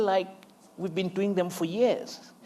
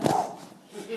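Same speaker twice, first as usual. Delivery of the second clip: relieved phew; 5 semitones higher than usual. Retold or imitated. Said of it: "And I'm like".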